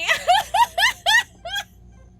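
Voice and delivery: loud, high laughing shriek; panicked laugh. Real, not acted. Scared laughter.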